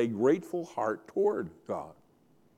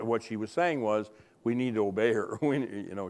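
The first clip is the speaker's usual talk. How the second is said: ordinary talk, nothing marked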